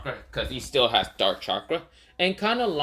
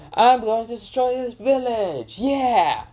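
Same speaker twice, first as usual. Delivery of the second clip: high, loud gleeful villain voice; Shukaku's menacing boast; 10 semitones higher, 4 dB louder, slower than usual. Acted. A monster gloating to Naruto about wrecking the village, high and loud. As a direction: crow it out like a giddy monster. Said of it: "Shukaku says".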